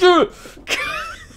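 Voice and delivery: high squeal of fear, played for laughs; panicked yelp. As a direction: squeak like you're clinging on for dear life. Imitates own panicked yelp.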